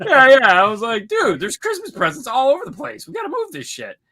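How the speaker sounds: high-pitched and loud, rushing; telling his buddy the presents must move; excited retelling. Retold or imitated.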